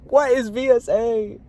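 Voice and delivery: high exasperated demand; baffled outcry. Real, not acted. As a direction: pitch it way up in frustration. Baffled by the device.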